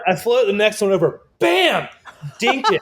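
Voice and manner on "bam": high, sharp mock impact; crash sound. Storyteller acting out the print smashing into the table, a sharp high bam. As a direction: slam out the crash of the print hitting. Second print hits the edge.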